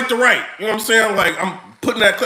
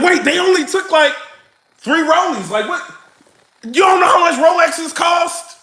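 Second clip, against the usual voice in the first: incredulous outrage; 8 semitones higher than usual. Retold or imitated. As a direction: blurt it out in shocked disbelief. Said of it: "You like".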